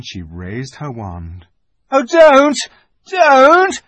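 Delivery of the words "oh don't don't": much higher and louder, desperate; shouted plea. Acted. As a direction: beg the witch to stop. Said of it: "shouted Edmund".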